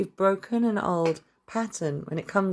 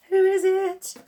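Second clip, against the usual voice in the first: high, loud eager asking; excited question; 14 semitones higher, 6 dB louder, slower than usual. Real, not acted. Reader asking her spirit guides about the Lovers card, thrilled high call. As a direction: beg the spirit for the answer, much higher and louder. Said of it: "I wanna know more".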